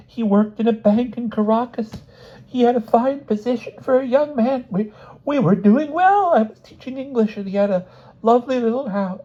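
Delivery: very high, distraught character voice; tearful outpouring. A narrator's reading. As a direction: pour out the confession, pitched high. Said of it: "words flowed from Missus Smith like".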